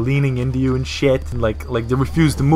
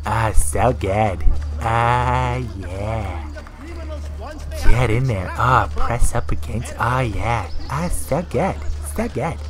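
slow, drawn-out creepy relish; creep voice; 2 semitones lower, slower than usual. Shown as a demonstration. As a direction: talk to yourself like a creep enjoying it. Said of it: "just bust that voice out".

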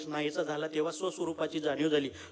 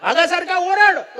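much higher, far louder furious command, slowing at the end; angry tiger roar; 11 semitones higher, 14 dB louder than usual. Acted. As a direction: bellow it like an enraged tiger. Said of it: "त्या जंगली वाघाला राग आला". (संतापलेल्या वाघाची उंच, मोठ्या आवाजातली गर्जना)

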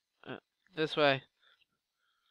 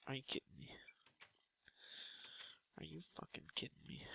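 frustrated whisper; 15 dB quieter, much faster than usual. Real, not acted. Muttering after death.

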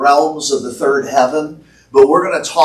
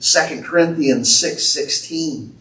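ordinary talk

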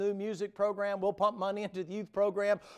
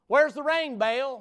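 loud, high taunt calling out to Baal; mocking shout; 8 semitones higher, 9 dB louder, slower than usual. Preacher mocking Baal's prophets with a loud taunt. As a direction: jeer up at the sky for rain.

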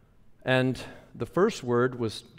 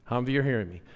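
normal talking voice

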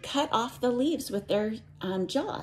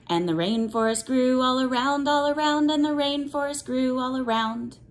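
put-on reading to children; 4 semitones higher than usual. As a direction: chant it like a picture book for little ones. Teacher reads cumulative rainforest book.

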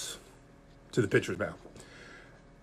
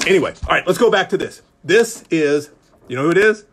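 ordinary talk

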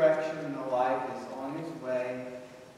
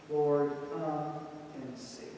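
pleading prayer; 4 semitones higher, 7 dB louder than usual. Acted. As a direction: pray it, each plea rising. Voiced prayer of lament.

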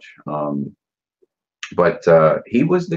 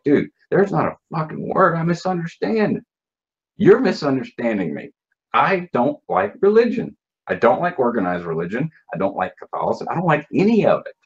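higher, heated retelling of the fight; retold angry outburst; 10 semitones higher than usual. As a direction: relive the row, voice pitched up in frustration. Retold heated argument with supervisor.

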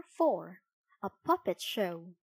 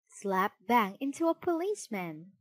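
ordinary delivery, nothing marked

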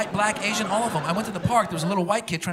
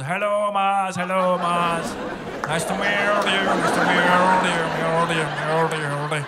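put-on Indian accent father-in-law; 4 dB louder than usual. Father-in-law greeting the comedian in an Indian accent. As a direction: greet him warmly in a thick Indian accent, repeating yourself. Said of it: "My father-in-law has it".

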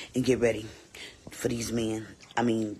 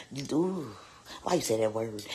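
normal talking voice

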